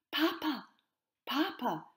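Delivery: high, loud joyful calls; child shouting. A narrator's reading. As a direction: call out to Papa across the park. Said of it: "Elise shouted".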